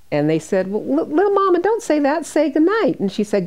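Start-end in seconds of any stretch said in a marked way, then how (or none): 0.7-3.0 high coaxing plea; gently pleading, voice lifted high; family coaxing. Retold or imitated. Family at bedside begging her to say good night, high coaxing plea.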